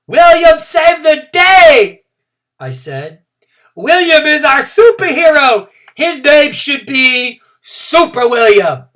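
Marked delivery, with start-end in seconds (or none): 0.1-2.0 slow, much higher and louder shout; proud proclamation. A narrator's reading. Girl hailing William as hero, proud shout. 3.8-8.9 slow, much higher and louder shout; proud proclamation. A narrator's reading. Girl hailing William as hero, proud shout.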